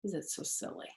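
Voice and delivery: usual speaking voice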